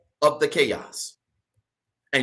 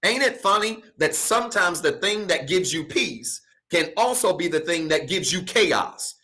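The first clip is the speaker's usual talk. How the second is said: ordinary delivery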